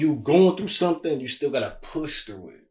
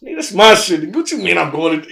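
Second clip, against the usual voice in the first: louder and higher, that's my shit; fired-up reply; 5 semitones higher, 11 dB louder than usual. Retold or imitated. Man answering friends who push him, fired up. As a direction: snap back fired up. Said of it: "you be like".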